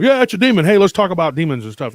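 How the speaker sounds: high, loud mock enthusiasm; mock eagerness. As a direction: blurt it out like over-keen small talk. Acting out what he can't say.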